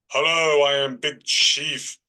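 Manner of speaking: hammed-up fake trance voice, booming then fading away; put-on spirit guide voice. Shown as a demonstration. Medium faking a trance for students, put-on chief's voice. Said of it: "I just put on a voice".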